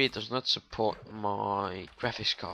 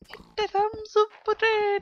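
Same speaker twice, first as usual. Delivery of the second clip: squeaky voice; 6 dB louder than usual. Acted. Asking viewers for a like in a squeaky high voice. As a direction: jump into a tiny squeaky high voice, louder. Sudden squeaky plea for likes.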